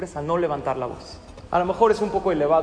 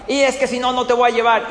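angry parent; 5 semitones higher, 3 dB louder, faster than usual. Acted. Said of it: "hacemos muchas, muchos corajes".

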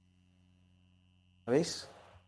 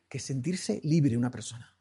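ordinary talk

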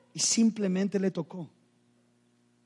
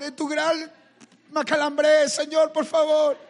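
high, whiny long-winded mock prayer; mock pleading; 9 semitones higher, 4 dB louder than usual. Peter over-explaining to the Lord while sinking, mock pleading. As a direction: plead elaborately, hammed-up and high. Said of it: "No le dijo".

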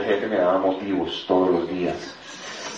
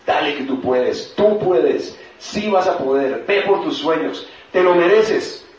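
raised, rallying voice; 6 semitones higher, 4 dB louder, slower than usual. Acted. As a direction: cheer someone on, building higher and louder. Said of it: "el lobo blanco te habla".

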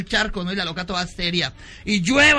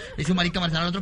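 ordinary talk, nothing marked

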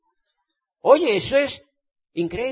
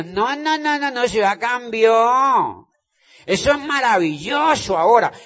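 insistent denial; 6 semitones higher, 6 dB louder than usual. Retold or imitated. Insisting the city changed.

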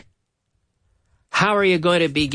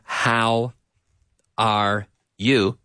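slow, separated words; 6 semitones lower, much slower than usual. Shown as a demonstration. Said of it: "the way we link the words together".

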